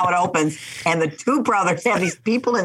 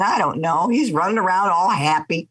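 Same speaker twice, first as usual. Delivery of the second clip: usual speaking voice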